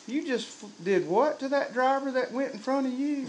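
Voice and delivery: hushed disbelief from up in heaven, played for laughs; mock-appalled God looking down at a driver; comic voice of God. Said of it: "God is up there going".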